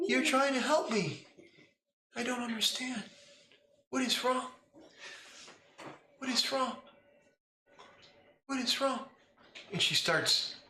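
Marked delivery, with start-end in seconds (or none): 0.1-9.0 put-on a little girl bewildered and hurt; small child form. Child Lila asking the player what's wrong, high confused voice. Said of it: "changes into her small form".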